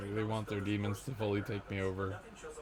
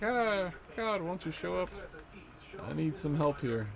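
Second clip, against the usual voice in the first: high, mock-plaintive cry for help; imitated plaintive appeal; retold plea; 8 semitones higher than usual. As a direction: call up pleadingly, lightly acted. Retells own plea to God.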